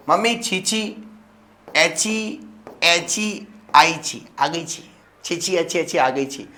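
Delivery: put-on high childish lisp; sing-song it like a toddler; lightly voiced lisping toddler. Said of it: "तुमने बोला".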